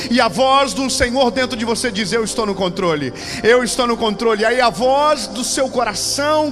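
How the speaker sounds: loud, driving sermon delivery; full-force preaching. Acted. Preacher pitting God's voice against fear, preaching at full force. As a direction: press on loudly, voice against voice.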